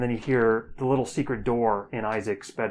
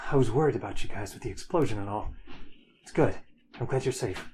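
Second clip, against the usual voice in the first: Hero's voice; faster than usual. Acted. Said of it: "In Hero's voice".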